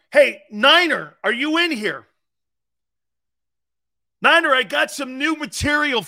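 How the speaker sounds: loud, high call across the room. Real, not acted. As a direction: call out for someone nearby. Calling out to Niner.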